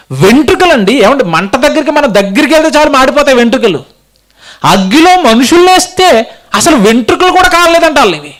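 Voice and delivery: high, projected preaching voice; impassioned preaching. Acted. Preacher marvels to congregation at unburnt hair, impassioned preaching. Preacher exclaiming over fire miracle.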